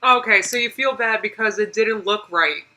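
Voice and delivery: ordinary delivery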